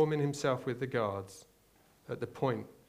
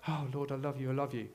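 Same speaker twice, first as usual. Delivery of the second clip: softer, sincere little prayer; a lightly voiced quiet devotion; mock worship; 3 dB quieter, faster than usual. Worshipper whispering love to God, soft and sincere. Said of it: "in worship and you're like".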